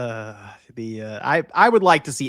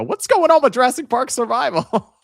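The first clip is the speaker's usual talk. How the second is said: laughing quote; 11 semitones higher, 6 dB louder than usual. Retold or imitated. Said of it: "There was a post".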